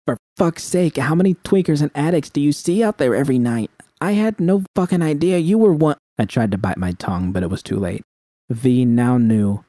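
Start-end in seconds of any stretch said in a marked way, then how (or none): 0.1-6.0 V, angry outburst. A narrator's reading. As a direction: throw it back at her.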